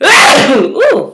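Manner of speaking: loud real sneeze; a sudden sneeze out loud; sneezing. Real, not acted. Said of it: "Excuse me, I have to sneeze".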